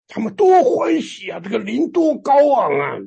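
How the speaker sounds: high-pitched, elated preaching; joyful exclamation. Retold or imitated. Preacher marveling at how glad the believers were, exultantly.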